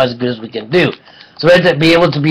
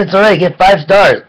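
ordinary talk

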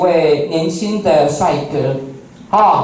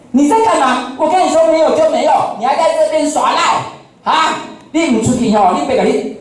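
raised-pitch imitation of a shouting boss; boss blowing up; 8 semitones higher than usual. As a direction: bark like a furious shop owner throwing him out. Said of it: "他发飙了".

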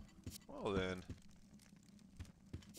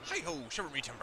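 put-on old sailor voice; 8 semitones higher than usual. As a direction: play a cheery old fisherman. Voices the fisherman NPC.

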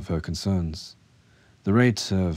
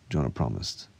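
plain reading voice

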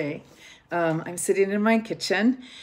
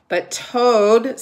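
louder, higher protest; protesting call; 4 semitones higher, 10 dB louder than usual. A narrator's reading. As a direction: call out to stop him. Frog protesting.

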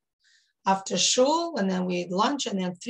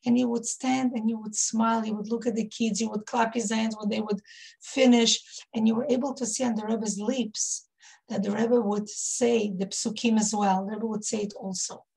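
normal talking voice

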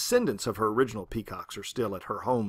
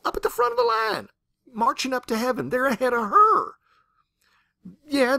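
excited emphasis; 11 semitones higher, 5 dB louder than usual. Real, not acted.

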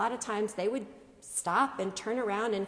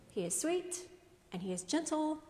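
softer, higher, lightly put-on voice; mock sweetness; 5 semitones higher, 4 dB quieter, faster than usual. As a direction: gently imitate people saying nice things. Voices people's mild view of Jesus.

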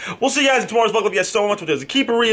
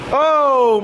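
high, loud startled oh; sudden real fright; 10 semitones higher, 5 dB louder than usual. Real, not acted. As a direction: jump as someone creeps up on you. Startled by someone creeping up.

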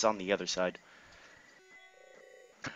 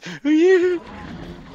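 high, loud squeal of laughter; startled high laugh; high laughing shriek; 9 dB louder than usual. Real, not acted. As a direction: burst out in a loud high cackle. Reacting to game scare.

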